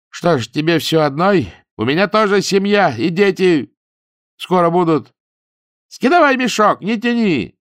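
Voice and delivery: angry demand. A narrator's reading. Ninka keeps demanding.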